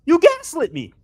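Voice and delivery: high, loud, exasperated outburst; frustrated rant. Real, not acted. Backer rants at the company over changed numbers, loud and frustrated. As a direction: raise pitch and volume, accusing them hotly. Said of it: "I'm so fucking frustrated".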